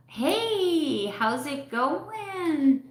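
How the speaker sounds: high, slow hello to a viewer; excited high hello; bright greeting. Real, not acted. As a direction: greet her high and drawn out.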